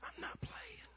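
soft, voiceless reply; whispered protest. Retold or imitated. Benched son turns round to his dad, whispering.

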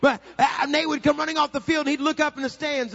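high, shouted preacher delivery; full-force preaching. Real, not acted. Preacher tells of son's senior season, shouting. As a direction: shout the story to the congregation.

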